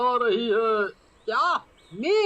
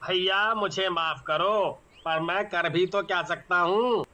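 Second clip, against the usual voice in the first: lower, helpless driver voice; low voice saying sorry, can't help; apologetic driver; 7 semitones lower than usual. Acted. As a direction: shrug it off as the stuck driver, low.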